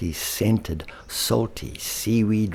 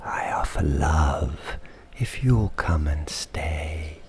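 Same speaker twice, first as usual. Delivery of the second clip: soft, tender whisper; sweet silent voice; 3 semitones lower, 3 dB quieter than usual. Acted. The green leopard softly beckoning lonely Thorpe in a whisper. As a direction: breathe the offer gently. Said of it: "her sweet silent voice".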